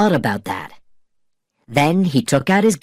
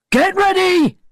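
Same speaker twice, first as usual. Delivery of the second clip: much higher shouted order; 3 dB louder than usual. A narrator's reading. Gibbs shouting to the other sailors, high ringing order. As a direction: bark the order to the crew. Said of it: "he shouted".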